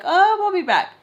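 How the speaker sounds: high, loud imitation of friends leaving; breezy retold exit. Friends announcing they are taking off, high and breezy.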